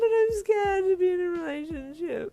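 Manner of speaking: very high, loud crying confession; tearful wail. A narrator's reading. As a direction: wail out the fear. Kari in tears, confessing fear.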